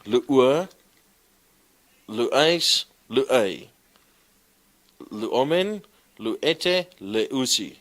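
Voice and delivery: loud, high drilled recitation of the forms; reciting by heart. Shown as a demonstration. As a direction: chant the conjugation out like a drill for the class. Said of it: "you must be so attentive that you know this by heart".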